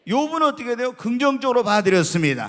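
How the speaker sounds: loud, high ringing proclamation; preaching at full force. Acted. Pastor proclaiming Job's faith to the congregation, loud and ringing.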